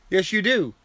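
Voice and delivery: mock-scolding a beloved pup; playful pet talk. Real, not acted. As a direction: coo at the dog in short, doting phrases.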